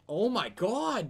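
stretched-out high shocked cry; drawn-out stunned oh my god. Real, not acted. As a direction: drag it out in disbelief. Gamer stabbed, raging reaction.

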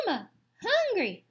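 high little gosling cry; baby gosling. Acted. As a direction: squeak it like a hungry chick. Gosling calling its mother.

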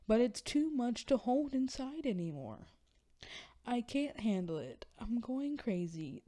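performed desperation, pitch swinging upward; anguished dramatic monologue. Acted. Reading a monologue about hidden depression, impassioned and pleading. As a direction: build a pleading stage outburst, then let it fall at the end.

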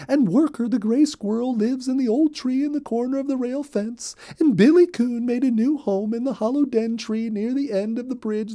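a busy little rabbit spilling all the news; Molly Cottontail. Acted. Molly voiced in read-aloud story.

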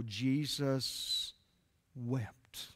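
soft, hushed and slow, each word left hanging; hushed reverent. Real, not acted. Preacher to his church, hushed and reverent. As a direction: let it fall to near silence. Shortest verse, dramatic hush.

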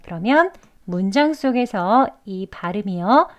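usual speaking voice

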